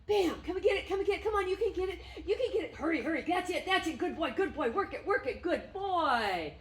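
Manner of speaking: high, loud eager urging; bubbly rapid encouragement, laughing at the end; excited coaxing. Real, not acted. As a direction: keep him working for the treat. Coaxing dog to jump.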